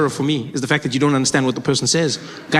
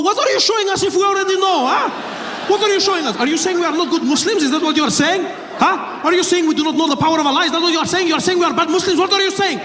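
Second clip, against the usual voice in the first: put-on high, accented barrage of offended questions; indignant passenger; 12 semitones higher than usual. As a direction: hector him in a put-on Middle Eastern accent. Acts indignant quarrel with terrorist.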